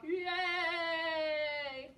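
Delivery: long, loud singsong cheer. Real, not acted. Cheers after the chant.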